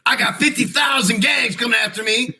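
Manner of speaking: mock shout. Joking about gangs chasing him.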